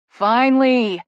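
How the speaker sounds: loud relieved call; cry out. A narrator's reading. Tess as Caleb arrives, loud relieved cry. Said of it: "Tess cries".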